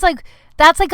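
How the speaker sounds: ordinary talk, nothing marked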